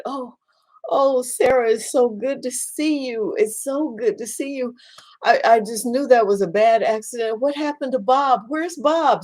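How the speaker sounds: high, bright-eyed relief at seeing a friend; hospital patient glad to see her friend, happy and high; cheerful patient greeting. Acted. Patient greets friend after crash.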